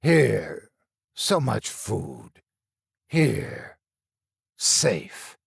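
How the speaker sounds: slow, broken monster speech; each "Here" falling, halting; a lightly voiced rasping thing; creature voice. A narrator's reading. Monster speaks in story.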